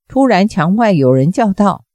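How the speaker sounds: usual speaking voice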